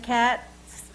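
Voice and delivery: cats with a crisp ts at the end; ts sound demo. Shown as a demonstration. Showing the class the ts ending of cats. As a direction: stress the final ts in cats. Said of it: "When you say the word cats".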